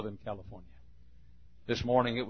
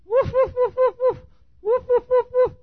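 loud, very high yapping woofs; shrill high Chihuahua yaps, louder; 9 dB louder than usual. Acted. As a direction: bark frantically like a tiny dog. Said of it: "inside that fence going".

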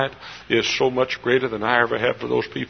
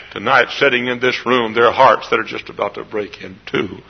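ordinary talk

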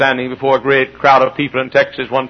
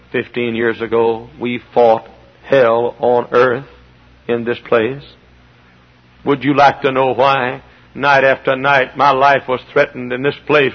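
normal talking voice

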